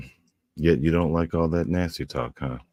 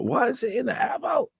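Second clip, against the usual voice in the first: startled high yelp. Retold or imitated. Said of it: "I was like".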